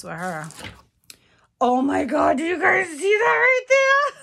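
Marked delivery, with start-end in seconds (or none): 1.6-4.2 high, loud, laughing squeal of delight; thrilled shout. Real, not acted. To viewers, seeing the picture, a real excited squeal. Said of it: "I'm so excited".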